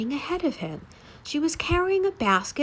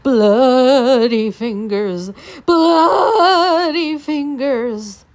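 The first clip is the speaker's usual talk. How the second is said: very slow, loud ghost moan; ghostly moan; 9 dB louder, much slower than usual. A narrator's reading. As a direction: draw it out like a moaning ghost. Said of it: "it was moaning".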